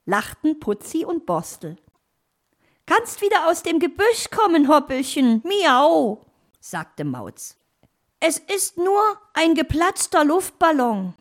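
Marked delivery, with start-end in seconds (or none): 2.9-6.2 high, loud talking-cat voice; cat character. Acted. Cat urges fox down from the tree, high cat voice. Cat Mauz calls hare out. 8.2-11.1 high, loud talking-cat voice; cat character. Acted. Cat tells hare it's only a balloon, high cat voice.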